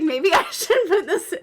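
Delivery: can barely get it out for laughing; giggling at her own stupid idea. Real, not acted. Laughing while retelling.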